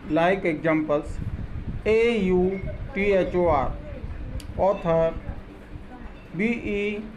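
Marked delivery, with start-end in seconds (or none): none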